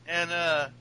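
ordinary talk

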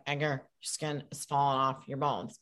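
low, quiet, expressionless delivery with no emotion at all; expressionless Syracuse girl. Retold or imitated. Actress imitating a Syracuse girl for the director, expressionless. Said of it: "an imitation of someone I knew from Syracuse".

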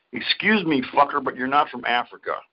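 ordinary delivery

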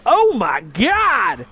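put-on high, long-drawn exclamation, British accent lost; botched British accent. Actor retells turning to Holmes at audition, botched British accent. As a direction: stretch it loud and high, accent collapsing. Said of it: "turned to Holmes and go".

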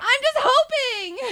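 high, slow, stretched-out wail; loud protest. Real, not acted. Co-host admitting she has no impression yet, loud protest.

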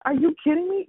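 normal talking voice

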